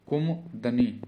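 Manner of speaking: ordinary talk, nothing marked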